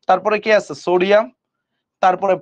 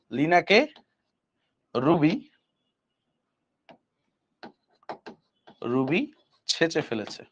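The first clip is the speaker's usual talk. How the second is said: slow spelled-out rhyme; 3 semitones lower, 8 dB quieter, slower than usual. Shown as a demonstration. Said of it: "আমরা ছন্দ পড়াই".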